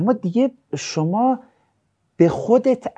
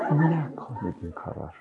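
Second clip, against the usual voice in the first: affected voice; 6 semitones lower, 10 dB quieter than usual. Retold or imitated. Mocking students' affected speech.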